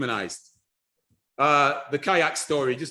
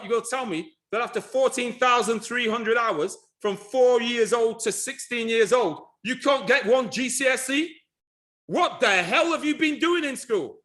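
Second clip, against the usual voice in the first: high-pitched, exasperated telling-off; incredulous scolding; 6 semitones higher than usual. Retold or imitated. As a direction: build disbelief to a high, outraged question. Acting out berating failing school pupils.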